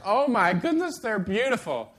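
delighted wife. Retold or imitated. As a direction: play the wife swooning over the flowers. Wife receiving flowers, acted out.

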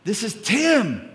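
calling out high and loud to be heard; raising voice on phone. Retold or imitated. Son shouting to his dad on the phone. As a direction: shout down a bad phone line. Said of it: "raising my voice".

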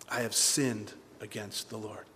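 slow, low, soft admission; simple confession. Acted. David simply owning his sin, solemn confession. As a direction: weigh each word quietly.

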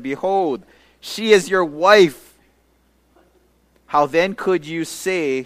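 loud, slow rebuke with she is your wife pitched high; a king calling out a lie, loud and drawn out; king's rebuke. Acted. King confronts Isaac in the reading.

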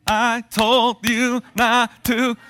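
high, loud, very slow drawn-out scolding; mother scolding the child with syllables; mock parent scolding. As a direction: stretch out every syllable like an angry mother.

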